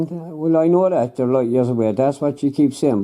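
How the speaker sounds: ordinary talk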